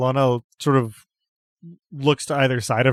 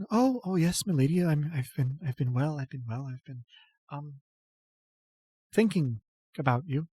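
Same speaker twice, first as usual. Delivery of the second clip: put-on a devoted girl trailing off shyly; flustered devotee; 3 semitones higher, 5 dB quieter than usual. Ilona answers her lady, stammering and fading away.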